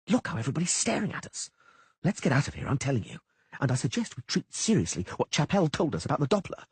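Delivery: high, fast-talking frightened troubadour; nervous poet Dandelion. A narrator's reading. Frightened bard Dandelion warning Geralt about Chapelle, high and anxious. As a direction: rattle it off high and scared like a jittery bard.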